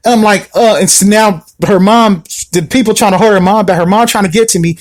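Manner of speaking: usual speaking voice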